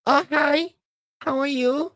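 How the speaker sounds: high, gentle Hong Kong mom voice; soft-spoken Cantonese accent. Retold or imitated. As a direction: imitate a sweet Cantonese-speaking mom. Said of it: "Very soft spoken".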